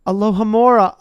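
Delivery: ordinary delivery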